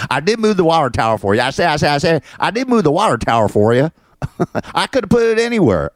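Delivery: mocking rooster impression of a supervisor; Foghorn Leghorn. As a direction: protest like a blustering cartoon rooster. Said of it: "I call him Foghorn Leghorn".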